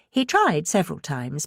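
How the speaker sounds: usual speaking voice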